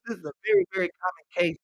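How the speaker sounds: normal talking voice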